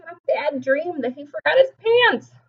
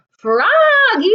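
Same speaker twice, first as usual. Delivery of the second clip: high, loud call; 11 semitones higher, 7 dB louder than usual. A narrator's reading. The teacher calling Froggy in his dream, loud high call. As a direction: call the child by name. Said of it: "called his teacher".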